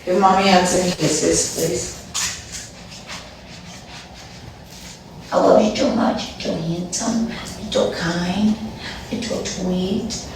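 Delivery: soft, cooing words to the dogs. Real, not acted. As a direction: coo to her pets like babies. Baby talk to her dogs.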